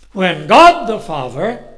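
loud, rising to a high preaching peak; preaching the Trinity. Acted. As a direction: drive the point home with force.

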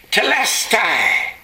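high, long-drawn triumphant cry. Acted. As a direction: cry it out slowly from the cross. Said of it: "cry out in a loud voice".

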